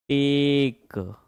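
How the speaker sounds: टेक stretched very slowly, one long held vowel; drawn-out sounding out. Shown as a demonstration. Teacher showing students how he learned to read, drawn-out sounding out. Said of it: "मैंने ऐसे पढ़ना सीखा है".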